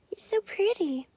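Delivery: high, soft whisper to the dog. Real, not acted. Owner whispers praise to her dog Bear, soft and high. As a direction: whisper sweet praise to a pet.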